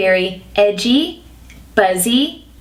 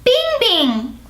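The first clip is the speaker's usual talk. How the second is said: put-on very high, childlike "Bing, bing" once more; childlike head voice; 9 semitones higher than usual. Shown as a demonstration. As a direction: sing "Bing" way up like a baby. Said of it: "Let's do it again".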